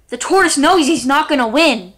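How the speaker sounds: ordinary delivery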